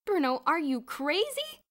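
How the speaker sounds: slow, high, loud disbelief; incredulous high-pitched yell; crying out. A narrator's reading. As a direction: cry out in alarmed astonishment. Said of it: "Boots cried".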